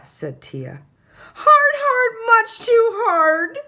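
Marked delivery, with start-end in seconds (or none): 1.3-3.6 very high, much louder yell. A narrator's reading. Little brother yells to Mummy about the gorilla, very high loud yell. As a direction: bellow it like a squashed little boy. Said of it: "yelled Tate".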